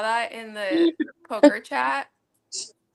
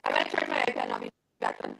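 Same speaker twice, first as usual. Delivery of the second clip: garbled robotic mic sound; 6 dB quieter, much faster than usual. Real, not acted. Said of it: "it's definitely on your end".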